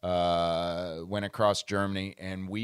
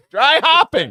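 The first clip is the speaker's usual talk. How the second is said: louder, much higher, laughing through Dry hopping; 15 dB louder than usual. Real, not acted. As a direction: burst out laughing at the answer. Laughing at the answer.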